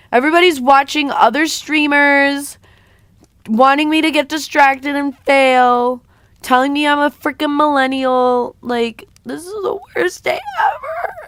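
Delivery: high, loud whining rant; mock tantrum, voice squeaking up; high-pitched whiny yell. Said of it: "when I try to get loud, I just get high-pitched".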